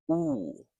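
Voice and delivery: "oo" held as a long, pure vowel. Shown as a demonstration. Teacher isolates the double O sound, long "oo". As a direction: hold out "oo" as in move and food. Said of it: "This is the long oo sound".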